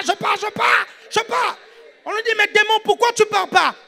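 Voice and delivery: high, loud, rapid-fire sermon shout; preaching at full force. Acted. Preacher acting out two believers commanding a demon, fired-up. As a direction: shout the exorcism scene to the congregation.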